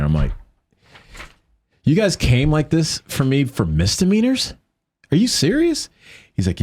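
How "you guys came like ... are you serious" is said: much higher, disbelieving protest; can't believe it's only misdemeanors, voice shooting up; incredulous. Retold or imitated. Retold disbelief at charges.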